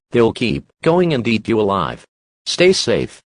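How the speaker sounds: ordinary delivery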